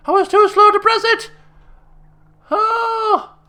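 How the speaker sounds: real frustrated wail; real frustration. Real, not acted. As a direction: wail at your own mistake. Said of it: "player missed a timed press".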